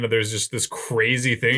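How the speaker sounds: ordinary talk, nothing marked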